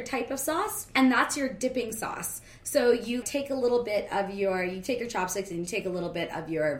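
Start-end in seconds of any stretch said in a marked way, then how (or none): none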